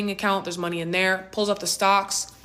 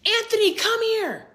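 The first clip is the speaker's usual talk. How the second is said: mom hollering for her son, high-pitched and drawn out; mom calling out; 13 semitones higher, 4 dB louder, slower than usual. Retold or imitated. Mom summons her son from the kitchen, high drawn-out holler. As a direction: imitate mom's high, stretched-out summons. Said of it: "you hear our mom go".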